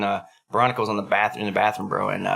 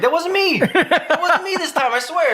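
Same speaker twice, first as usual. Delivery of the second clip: indignant denial; slower than usual. Retold or imitated. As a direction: plead innocence in a raised, squealing pitch. Protesting an accusation, acted.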